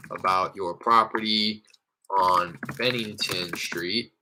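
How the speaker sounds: slow, halting word-by-word dictation; typing aloud. Real, not acted. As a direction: say it as you type it, with gaps. Composing a text aloud while typing.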